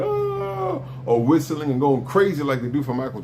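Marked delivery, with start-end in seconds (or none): 0.0-0.8 a mock crowd cheer, much higher; imitating crowd cheer. Describing an unexcited crowd, mock cheering sound. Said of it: "the crowd is not going".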